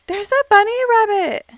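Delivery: high, loud squeal of delight; thrilled player spotting a bunny; delighted gasp. Real, not acted. Gasps at a rabbit.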